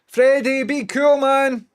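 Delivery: high, loud call to Freddie; calling out to the dog. Real, not acted. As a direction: shout at the dog to calm down. Shouting at his dog.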